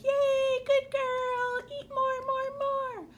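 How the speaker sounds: high, delighted pet-talk praise; cheering the puppy on. Retold or imitated. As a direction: praise her like a proud owner. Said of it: "I'm like".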